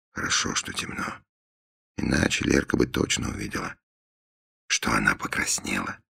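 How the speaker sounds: unvoiced private whisper; whispered thought. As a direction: whisper it to yourself.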